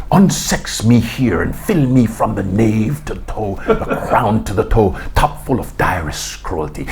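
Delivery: low, slow theatrical recitation of Lady Macbeth; low, unhurried Shakespeare monologue, whispered near the end; reciting Shakespeare. Retold or imitated. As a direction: declaim it slowly and darkly, like a stage soliloquy. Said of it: "I began to recite Shakespeare to myself".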